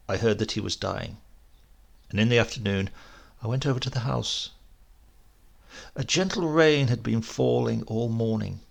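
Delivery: plain reading voice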